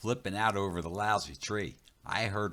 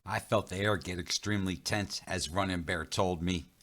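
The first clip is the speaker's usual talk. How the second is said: plain reading voice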